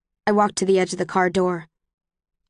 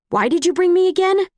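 high, loud sarcastic challenge; angry; 10 semitones higher, 4 dB louder than usual. Acted. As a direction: fling the question back, much higher. Quarrel on the train.

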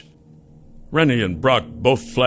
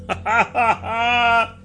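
high, laughing mockery; 14 semitones higher than usual. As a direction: laugh right at him. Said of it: "laughed at him".

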